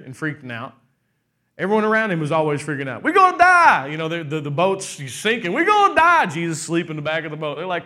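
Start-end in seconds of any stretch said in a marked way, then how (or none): 3.0-3.9 high, loud panicked cry; panicked disciples. Retold or imitated. Disciples panicking in sinking boat. 5.5-6.2 high, loud panicked cry; panicked disciples. Retold or imitated. Disciples panicking in sinking boat.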